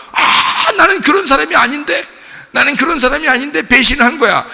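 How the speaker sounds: high, loud cry of disbelief at himself; anguished Judas. Acted. Judas horrified at his own betrayal, anguished cry. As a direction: wail it out, strained high and loud. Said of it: "배신을 하고 나니까".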